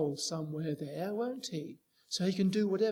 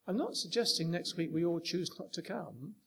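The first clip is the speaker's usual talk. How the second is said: ordinary talk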